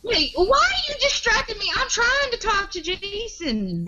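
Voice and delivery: very high-pitched whiny complaint; exasperated protest. Real, not acted. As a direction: protest the interruption in a squeal of frustration. Protests being interrupted on her call-out.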